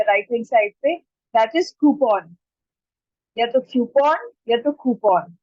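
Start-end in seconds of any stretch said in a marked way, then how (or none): 1.8-2.3 "coupon" as KOO-pon; alternative pronunciation. Shown as a demonstration. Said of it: "या फिर एक तरीका और है". 3.4-5.4 first "coupon" as KYOO-pon, second as KOO-pon, slowly; two correct pronunciations. Shown as a demonstration. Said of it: "it's either of them".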